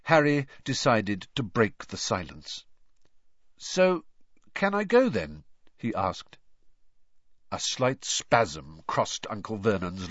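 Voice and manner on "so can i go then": put-on high boy's voice, tentative question; hopeful boy. A narrator's reading. Harry breaking the silence to ask his uncle, tentatively. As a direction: ask permission in a higher young voice.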